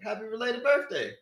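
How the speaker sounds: high-pitched "related", R replacing the B; mispronounced belated. Retold or imitated. Answering his uncle, wrongly saying "related" with an R. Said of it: "used to always say that wrong".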